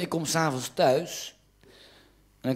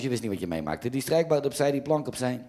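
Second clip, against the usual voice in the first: ordinary delivery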